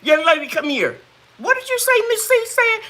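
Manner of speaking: high-pitched summons to a student; calling the student over, sharp and high; stern director calling over. Retold or imitated. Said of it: "And she said".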